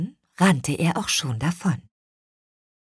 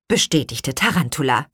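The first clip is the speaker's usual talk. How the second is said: plain reading voice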